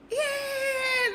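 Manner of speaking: very high, strained yelled yeah; playful scream. Pretend. Streamer screaming for viewers who asked, very high shriek. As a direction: shriek it out for the chat. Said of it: "that is my scream".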